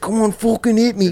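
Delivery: high, hammed-up Scouse accent; Liverpool accent imitation. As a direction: mimic a Liverpudlian egging someone on. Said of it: "when I do your accent".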